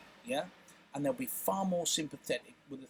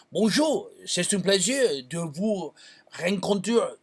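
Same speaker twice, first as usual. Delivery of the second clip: louder put-on French accent; 5 dB louder than usual. Shown as a demonstration. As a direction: play up a French accent with flair. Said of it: "I'm putting that accent on".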